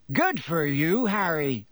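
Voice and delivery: mild roar of congratulation. A narrator's reading. Seamus Finnegan to Harry, mildly roared. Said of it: "roared Seamus Finnegan".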